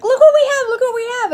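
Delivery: put-on high, loud kid squeal, repeated in a rush; excited little girls. Two little girls showing their dad the cups, excited shouting. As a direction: shout it sky-high like a thrilled child.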